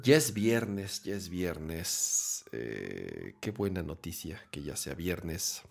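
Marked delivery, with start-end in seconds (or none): 1.0-2.4 slow, softer, drowsy repeat; drifting off to sleep; nodding off. Real, not acted. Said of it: "me estoy quedando dormido también". 2.5-5.6 sleepy, much softer; fading into sleep; nodding off. Real, not acted. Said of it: "me estoy quedando dormido también".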